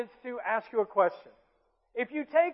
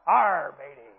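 low pirate growl on OUR, dropping away on matey; joking pirate; 9 semitones lower, 4 dB louder, slower than usual. Shown as a demonstration. Preacher jokes about his own drawl, joking pirate voice. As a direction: play a pirate, loud then trailing off low. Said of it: "I kinda sound like a pirate".

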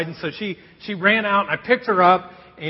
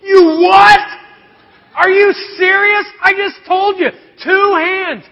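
much higher, louder incredulous outburst; exasperated dad; 11 semitones higher, 9 dB louder than usual. Retold or imitated. Dad yelling at daughter over the dropped cone, high and loud. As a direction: blow up at a careless kid.

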